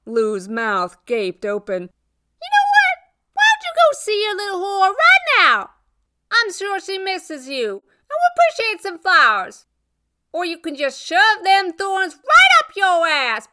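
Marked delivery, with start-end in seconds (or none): 1.8-13.5 high, loud scornful yelling; shrill, venomous rant; furious tirade. Acted. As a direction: tear into a cheating ex and send him off. Rose sends ex away, acted quarrel.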